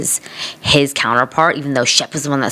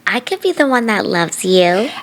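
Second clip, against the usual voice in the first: mock lovestruck girl; 8 semitones higher than usual. Mocking Landon's love confession.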